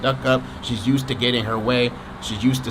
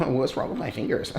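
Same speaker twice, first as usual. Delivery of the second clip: usual speaking voice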